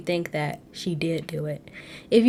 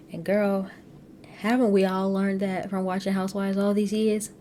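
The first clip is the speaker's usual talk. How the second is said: ordinary talk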